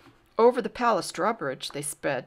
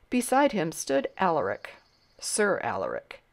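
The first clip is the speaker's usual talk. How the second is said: ordinary delivery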